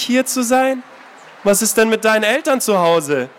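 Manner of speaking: horrified disbelief at his words; aghast. Acted. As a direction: entgeistert. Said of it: "fragte Anna entgeistert".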